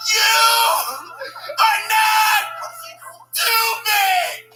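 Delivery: slow, very loud high shout; big shout. Acted. Kid shouts to the class that he is not stupid. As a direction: yell every word with long pauses.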